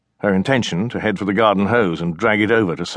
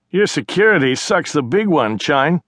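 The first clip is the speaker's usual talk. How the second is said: higher, louder teasing brother voice; cocky brother; 8 semitones higher, 3 dB louder than usual. Acted. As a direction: taunt her like a smug kid brother.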